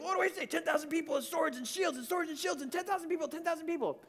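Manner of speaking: high, loud, fast panicked babble; acted-out flustered urgency; frantic praying. Acts out his frantic prayer.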